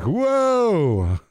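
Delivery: high, startled whoa; mock whoa. Listener reacting to the squeaky guy, high mock whoa. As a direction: react like you're taken aback. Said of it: "And you're like".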